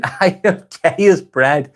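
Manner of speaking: laughing as it's read out; amused laughter. Real, not acted. Streamer reading an odd viewer comment, laughing. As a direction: read it through a drawn-out laugh.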